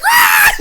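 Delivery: very high, very loud squeal; hammed-up fan scream; overexcited scream. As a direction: shriek like a way-too-excited fan. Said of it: "way too excited for that".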